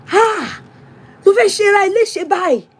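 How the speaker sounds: high, fast exasperated shout in Yoruba; husband shouting. Retold or imitated. Husband shouting at his wife for crying, high fast scolding. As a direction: scold her in a high rush. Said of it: "shouting on me".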